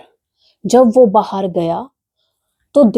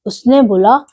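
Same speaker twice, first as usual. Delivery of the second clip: ordinary delivery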